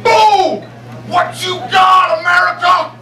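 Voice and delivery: mock shouted taunt at a party; mock yell. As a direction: bark a drunken challenge at him, slow. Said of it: "this dude just walks up to me and goes".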